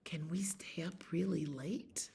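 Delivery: sneaky whispered ask; soft conspiratorial whisper; whispered request. Acted. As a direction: whisper it like a kid hoping. Child's whisper-asking in story.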